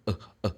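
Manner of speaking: soft, repeated lax "uh"; quiet little "uh", fully relaxed; schwa sound. Shown as a demonstration. Teacher isolates the schwa sound for learners, soft uh. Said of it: "This is the schwa sound".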